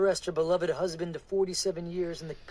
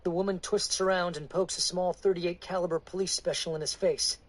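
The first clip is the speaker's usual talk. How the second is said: ordinary delivery, nothing marked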